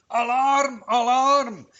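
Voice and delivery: high alarm call through the woods; the animals spreading the alarm. Acted. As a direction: call the alarm to the whole forest. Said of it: "haben jetzt alle durch den Wald gerufen".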